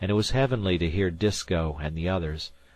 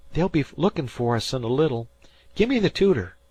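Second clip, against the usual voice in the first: put-on high, loud boy's voice, insistent; he swears the dead man came back; spooked fisher boy; 7 semitones higher, 4 dB louder than usual. A narrator's reading. Dan to Harvey, spooked high boy voice.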